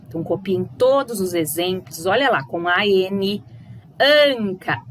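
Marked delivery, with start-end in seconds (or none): 4.0-4.8 anca with a loud nasal an. Shown as a demonstration. Teacher modelling the nasal an for pupils. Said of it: "ã, olha lá".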